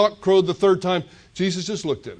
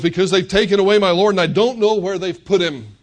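louder, higher and fast, pleading; distraught plea; 5 semitones higher, 7 dB louder than usual. Acted. Weeping Mary tells the angels her Lord is taken, distraught and loud. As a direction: cry out that the Lord is gone.

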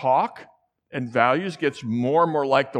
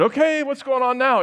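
much higher, puzzled "Okay, what's going on now?"; surprised question; 10 semitones higher, 4 dB louder than usual. Retold or imitated. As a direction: ask it up high and louder, baffled. Asking son amid the uproar.

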